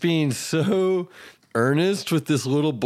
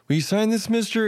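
higher-pitched imitation of a little kid; lightly put-on small boy asking politely; 9 semitones higher than usual. Kid asking his hero for an autograph, imitated little-boy voice. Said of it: "this little boy comes up to".

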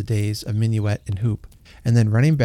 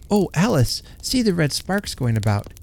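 put-on little boy; 6 semitones higher than usual. A narrator's reading. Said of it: "he said".

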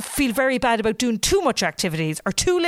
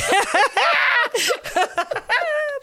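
big laugh. Real, not acted. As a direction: crack up at your own joke. Cracks up at own drummer joke.

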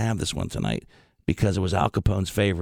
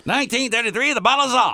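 high, loud hammy newsreel announcer; old newsreel voice; 13 semitones higher, 5 dB louder than usual. Retold or imitated. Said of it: "the newsreel voice".

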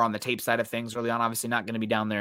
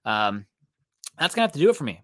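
ordinary talk, nothing marked

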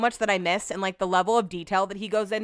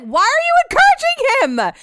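very high, loud outraged protest; incredulous shriek; 8 dB louder than usual. Retold or imitated. Woman scolding those egging on her stalker, shrieking in disbelief. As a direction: shriek the question in hammed-up disbelief.

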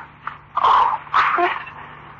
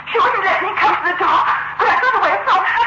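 higher, breathless panicked shout; frantic shout; 7 semitones higher than usual. Acted. Frightened woman bursts in.

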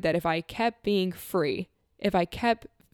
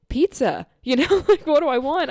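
high, loud and laughing; giddy excitement; 7 semitones higher, 7 dB louder than usual. Retold or imitated. Patient answering the therapist about food, giddy and loud. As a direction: burst out with delighted disbelief. Acting out delight at food freedom.

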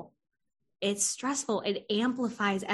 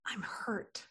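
breathy whisper, barely there; whispered hurt; 14 dB quieter than usual. Retold or imitated. Client admitting hurt to therapist, whispered. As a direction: let the hurt slip out in a whisper. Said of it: "they say".

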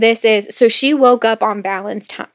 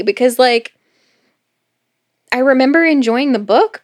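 ordinary talk, nothing marked

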